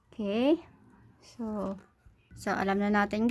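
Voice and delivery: ordinary talk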